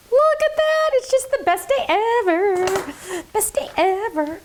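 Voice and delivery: very high, slow, sing-song delight; thrilled. Real, not acted. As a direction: coo over it like a treasure. Thrilled with the stamp.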